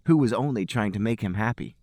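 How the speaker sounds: normal talking voice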